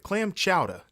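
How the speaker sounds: put-on Boston accent, dropping the r in chowder. Shown as a demonstration. Host triggering his accent with a local dish, Boston accent. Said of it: "how I always have to trigger is say chowder".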